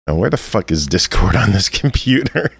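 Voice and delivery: ordinary talk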